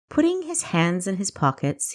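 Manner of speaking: ordinary delivery, nothing marked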